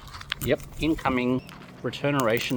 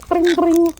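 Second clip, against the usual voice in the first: very high, loud mock ring ring; phone ring imitation; 11 dB louder than usual. Telling the bird it's on the phone, shrill mock ring. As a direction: squeal it like a ringing phone.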